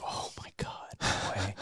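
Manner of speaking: hushed unvoiced whisper; whispered oh my God. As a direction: breathe it out in a panicked whisper. Retold panicked reaction in helicopter.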